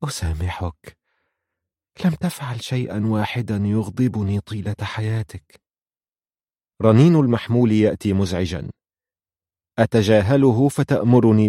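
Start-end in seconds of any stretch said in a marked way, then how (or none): 0.0-5.6 soft, faint voice of an ailing mother; weak voice. A narrator's reading. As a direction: forgive softly, with little strength. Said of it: "بالرغم من ضعف صوتها".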